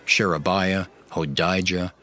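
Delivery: normal talking voice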